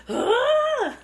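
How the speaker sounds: high, loud mock scream; short imitation of a horror-movie scream; imitated scream. Imitating heroine's famous scream, high mock shriek. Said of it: "she has a blood-curdling scream".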